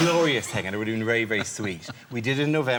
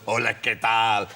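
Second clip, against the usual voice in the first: put-on a light Spanish accent, slower, with air kisses; Spanish greeting; 3 dB louder, slower than usual. Spanish man greeting an Irishman with a light Spanish accent and kisses. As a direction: greet warmly in Spanish, then smack two kisses. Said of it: "a man comes up and goes".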